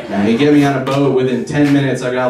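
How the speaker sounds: normal talking voice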